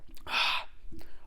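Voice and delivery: long unvoiced sigh of dread; soft bracing sigh; weary sigh. Real, not acted. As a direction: sigh before a chore. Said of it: "I'm not prepared for this part".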